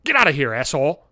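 high, nasal-free nerdy put-on voice; mock the haggling waiter demanding an order; geek waiter. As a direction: play a whiny pushy waiter. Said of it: "the fucking geek waiter with the buck teeth".

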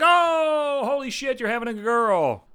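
high, gushing fake delight; hammed-up girly excitement; mock excited squeal. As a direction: squeal like a thrilled parent at a reveal. Mocking excited gender reveal parent.

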